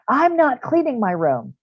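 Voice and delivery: high, loud, slow refusal; child refusing a parent, high and loud; defiant kid. Retold or imitated. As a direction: stamp it out like a stubborn child.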